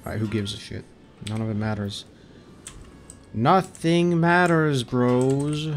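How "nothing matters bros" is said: mock nihilism. Real, not acted. Resigned outburst.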